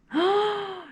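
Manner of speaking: sharp delighted intake of breath; awed gasp. Real, not acted. Gasping at her new glazed pieces. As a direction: gasp at the beauty of it.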